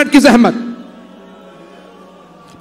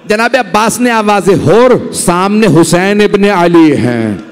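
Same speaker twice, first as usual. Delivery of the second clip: majlis preaching; 6 semitones lower than usual. Acted. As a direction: preach the Karbala story to move mourners. Majlis narration of Karbala. (मजलिस का दर्दभरा बयान)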